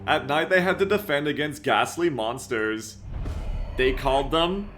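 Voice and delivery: ominous, slow trailer voice; trailer narration. Acted. Reads the trailer narration aloud.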